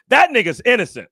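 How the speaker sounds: loud, high-pitched outburst; emphatic insistence. Retold or imitated. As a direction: proclaim it with total conviction. Voicing the conspiracy's punchline.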